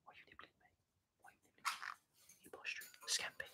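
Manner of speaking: soft whisper, barely voiced; whisper to the bird. Real, not acted. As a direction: hush it close to the parrot.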